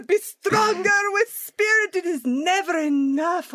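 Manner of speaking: high, drawn-out mock exhortation; hammed-up spiritual taskmaster; relentless guru parody. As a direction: stretch the commands out in a high put-on voice.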